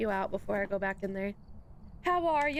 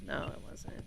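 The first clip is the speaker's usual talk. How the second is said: usual speaking voice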